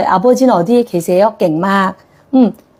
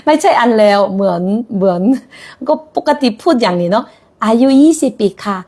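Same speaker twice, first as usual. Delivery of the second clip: ordinary delivery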